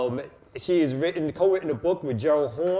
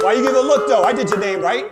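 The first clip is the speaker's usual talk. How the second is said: loud; 7 semitones higher, 10 dB louder than usual. Real, not acted. Teasing after loud intro.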